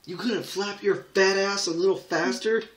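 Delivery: screaming at the bird, real rage; furious shout. Real, not acted. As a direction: yell at the bird for being too slow. Raging at the bird.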